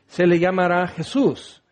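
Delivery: ordinary talk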